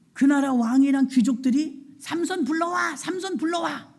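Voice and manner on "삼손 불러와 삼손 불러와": high-pitched mocking chant; jeering crowd call. King and nobles summon Samson for sport, jeering. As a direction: call out high, like a taunting mob. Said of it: "조롱하는 거예요".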